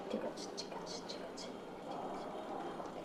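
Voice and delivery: barely audible murmur of reassurance; soothing whisper. Acted. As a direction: hush a sleepy child to rest. Soothes sleepy child.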